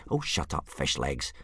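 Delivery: understated irritation, dropping away; impatient. A narrator's reading. Hiccup silences his fretting friend, mildly impatient. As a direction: brush him off impatiently. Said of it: "said Hiccup impatiently".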